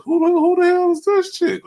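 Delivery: high, loud gasp of a question; amazed. Retold or imitated. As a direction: shout it out, stunned by her. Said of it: "I was like".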